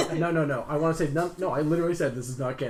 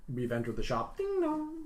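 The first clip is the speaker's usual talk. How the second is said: doorbell ding dong. Pretend. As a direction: chime it like a shop bell. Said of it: "happens, I guess".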